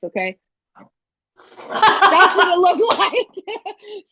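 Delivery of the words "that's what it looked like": slow, high, giggling delivery; laughing while pulling a goofy face; laughing through it. Shown as a demonstration. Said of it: "I'm gonna make this face".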